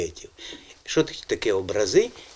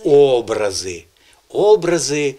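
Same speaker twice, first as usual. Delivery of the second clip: "образи" with a loud, long О at the start; "образи" as Образи, first syllable strong; stress on first syllable; 7 dB louder, slower than usual. Shown as a demonstration. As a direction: punch the О of "образи". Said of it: "Образи, образи, і залишилося нам ще один наголос".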